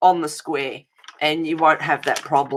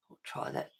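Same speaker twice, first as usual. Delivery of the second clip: whisper; 20 dB quieter than usual. Real, not acted. Muttering to herself while working.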